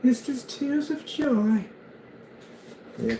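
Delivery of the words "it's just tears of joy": high falsetto voice. Retold or imitated. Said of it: "You're like".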